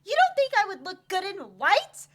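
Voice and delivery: high, very loud indignation; offended bride. Acted. Bride snapping at her fiance. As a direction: take real offence.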